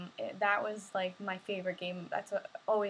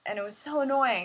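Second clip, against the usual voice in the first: ordinary delivery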